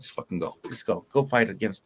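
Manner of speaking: a hint of a tired teacher brushing kids off, low and quiet; mock teacher. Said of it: "Teacher like".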